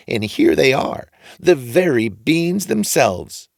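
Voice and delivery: high, drawn-out agreement; odd old man approving the boy; funny old man, slow. A narrator's reading. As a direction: draw it out knowingly. Old man shows beans.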